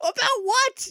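very high, exasperated question; mock-outraged squawk; incredulous outburst. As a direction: throw it back in disbelief. Continues rant at the bird.

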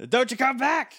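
high hammy villain yell; mock villain shout.

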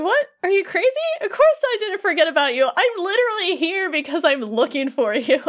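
Sora protesting. Acted. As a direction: protest in disbelief, lightly hammed-up. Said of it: "Sora's just like".

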